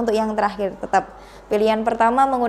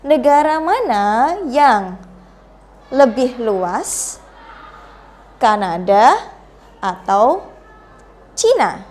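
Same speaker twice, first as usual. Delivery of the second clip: "Kanada" rising, "atau" rising, "Cina" falling; slow choice-question intonation; 3 dB louder, slower than usual. Shown as a demonstration. Teacher repeating the example in Indonesian, slow rise then fall. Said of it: "membaca dengan intonasi yang benar".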